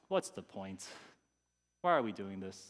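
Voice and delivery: low, deflated questioning; acted low-voiced weariness; discouraged. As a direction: let it sag, mildly despondent. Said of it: "I felt pretty discouraged".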